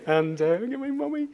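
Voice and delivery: mock-pitiful squeal, high and slow; pleading whine. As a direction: beg like a scared kid, half laughing. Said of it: "I was like this, going".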